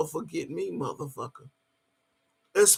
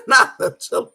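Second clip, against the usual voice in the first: laughing; 6 semitones higher, 8 dB louder than usual. Real, not acted. Laughing at her own line.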